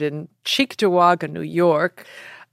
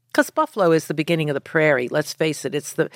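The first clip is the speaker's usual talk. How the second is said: ordinary delivery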